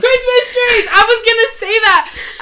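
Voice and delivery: high, excited exclamation; excited blurt. Real, not acted. Co-host hearing the answer she was about to say, excited blurt. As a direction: jump in high, thrilled to have guessed it.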